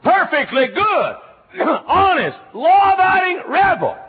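high, loud proud rebel boast; proud shout. Acted. Preacher proclaiming himself a law-abiding rebel, loud and high.

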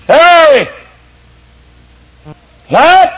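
high, loud crowd shout at the door; crowd yelling. Acted. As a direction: bellow his name from outside the door. Crowd calls out at Lot's door.

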